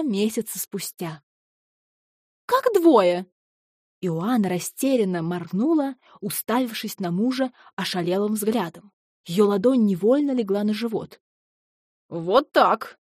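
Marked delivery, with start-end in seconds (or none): 2.5-3.3 high startled disbelief; stunned surprise. Acted. Ошеломлённая жена переспрашивает. 12.1-13.0 smug chuckle. Acted. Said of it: "хмыкнул Эрик".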